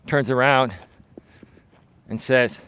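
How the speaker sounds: ordinary delivery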